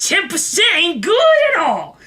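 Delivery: high, slow mimicked complaint; ranting bus passenger. Drugged man complains about tips to nobody, high holler. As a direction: draw out the imitated man's loud grievance. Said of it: "he's just like".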